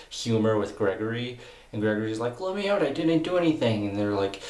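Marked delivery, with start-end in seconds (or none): 2.4-3.9 high mock-pleading voice; pleading protest. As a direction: protest innocence, pitched up like a comic weakling. Acted plea of locked-up character.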